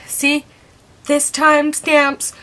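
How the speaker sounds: high, emphatic retold point-proving; insistent proof. Acted argument, showing coworker the proof.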